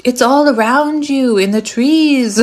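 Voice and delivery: slow, high, drawn-out mystical voice; playful, hammed-up sage impression; Grandmother Willow. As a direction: stretch it out like a wise storybook spirit. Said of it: "I'm hearing Grandmother Willow from Pocahontas".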